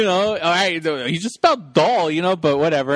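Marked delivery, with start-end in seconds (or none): none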